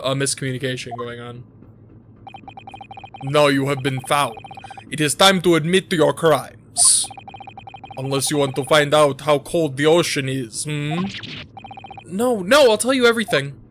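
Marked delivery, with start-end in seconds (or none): 3.2-11.0 badly faked Russian interrogator; mock-Russian accent, pressing for a confession; terrible Russian accent. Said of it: "My accents are terrible". 12.1-13.6 panicked pleading. Acted. Acted captive, threatened, gives in.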